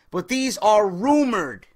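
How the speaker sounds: "rumored" drawn out slowly; slow, emphatic "rumored"; stress on rumored. Shown as a demonstration. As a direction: lean hard on "rumored". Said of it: "And I stress that word".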